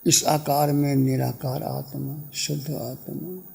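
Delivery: low meditation voice, phrases fading into silence; guided meditation. Real, not acted. Teacher guiding meditators to be still, guided meditation.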